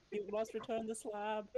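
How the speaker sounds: slow, high haunting ghost voice; ghostly voice. Acted. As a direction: moan it like a spirit. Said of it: "ghostly voice saying".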